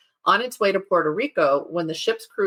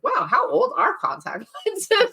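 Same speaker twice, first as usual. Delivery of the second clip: high, slowed amazed question; surprised wonder; 11 semitones higher than usual. Retold or imitated. Wondering about the optometrist on the boat, high slow surprise. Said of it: "which I was like".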